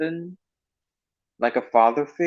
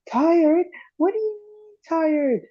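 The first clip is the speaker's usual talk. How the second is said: Spud indignant; slower than usual. Retold or imitated. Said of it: "It goes like this".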